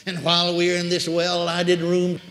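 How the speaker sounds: ordinary delivery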